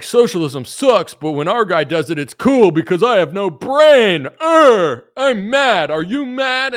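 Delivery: high, loud growling mock rant; over-the-top caricature of a raging partisan; mock angry troll. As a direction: ham up a dimwitted angry poster. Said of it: "they're like".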